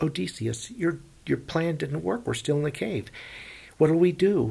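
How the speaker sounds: panicking sailors begging their leader; scared crew begging Odysseus what to do; frightened plea. Acted. Frightened men plead with Odysseus.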